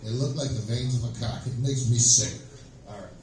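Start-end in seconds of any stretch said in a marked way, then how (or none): none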